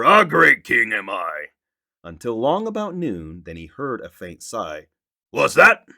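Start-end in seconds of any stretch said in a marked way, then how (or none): 0.0-1.5 loud, slow royal boast; a puffed-up king crowing over and over; boastful king. Acted. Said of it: "saying over and over". 5.3-5.9 sharp loud snap, rising; irritated king snapping a question; snapped. Acted. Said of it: "snapped the king".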